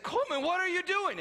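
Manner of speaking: high, loud call across the room; dad catching his son mid-mess, calling out loud. Retold or imitated. Father calling to his toddler, loud and high.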